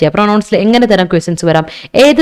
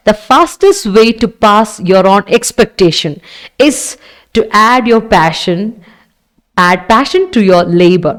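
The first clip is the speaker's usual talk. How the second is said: slow quote reading; much slower than usual. Shown as a demonstration. Teacher reads motivational quote.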